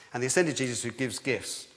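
usual speaking voice

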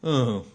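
mock-horrified oh; dismayed groan. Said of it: "they go".

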